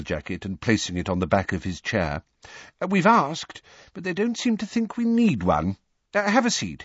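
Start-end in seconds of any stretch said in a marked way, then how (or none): 2.8-6.8 high, hesitant apology; sheepish excuse in a much higher voice; apologetic. A narrator's reading. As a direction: explain apologetically to a guest. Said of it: "said Mister Weasley apologetically".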